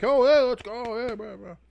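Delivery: happy voice demo. Shown as a demonstration. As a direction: sound giddy and upbeat. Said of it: "Happy Voice King".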